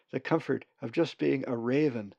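ordinary delivery, nothing marked

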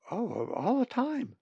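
high, slow chiming-in; voiced young raven. Molly agrees about the gray jays, high character voice. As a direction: chime in high, drawing it out.

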